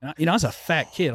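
normal talking voice

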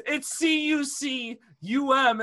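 each letter spelled out slowly and pointedly; slow pissy spelling. Retold or imitated. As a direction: spell it slowly like she's talking to a child. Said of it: "she goes".

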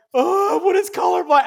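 high, loud, drawn-out mock wail; retold childhood panic. Third grader reacting to being told he's colorblind, in mock tearful panic. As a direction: exaggerate a scared kid's alarmed cry. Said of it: "I started crying".

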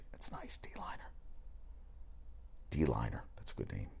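very soft, voiceless whisper; whispered aside. Real, not acted. Hushed reaction to a funny name.

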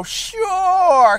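high, drawn-out yes; evasive fib. Retold or imitated. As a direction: stretch it high to avoid openly lying. Acting his evasive fib.

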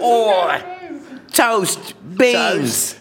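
high, hammed-up British accent; put-on British, each word called out alone. Guest mocking British food for laughs, hammed-up British accent. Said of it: "Is that a good accent?".

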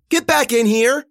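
high, loud shout of a name; yelling. A narrator's reading. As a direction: call out to her across the water. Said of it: "Frankie yelled".

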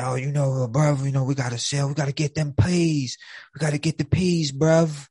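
a hammed-up British gangster voice; imitating a British drug dealer in a terrible British accent; bad British accent. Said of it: "I got a terrible British accent".

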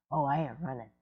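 quiet, unbothered tough-cat act; lightly voiced badass cat. Owner voices cat refusing to flee, low cool defiance. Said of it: "this badass cat".

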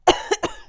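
sudden choking cough; coughing fit. Real, not acted. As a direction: cough from tea down the wrong way. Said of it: "I just drank my tea and went the wrong way".

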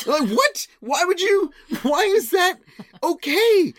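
high-pitched, exasperated disbelief; incredulous. Acted. Adult child questioning mom lying to doctor, incredulous. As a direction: shoot up high with each baffled question.

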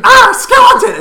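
high, loud mock-terrified shriek; startled scream. Retelling a crewmate's scare at a chicken, startled scream. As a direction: shriek it like a sudden jump scare.

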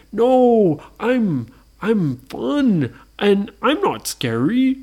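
high, drawn-out friendly-monster protest; loud start, slow and emphatic; protesting Frankenstein. Acted. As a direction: stretch each word, insisting.

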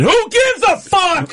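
high, drawn-out heckler yell; angry heckle. Retold or imitated. Somebody in the corner heckles the comic, high angry yell. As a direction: holler it slowly from the back, imitating anger. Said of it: "This guy's so angry".

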